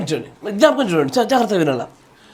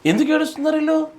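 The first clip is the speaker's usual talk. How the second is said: incredulous question; 7 semitones higher, faster than usual. Real, not acted. Preacher marvels at their crying.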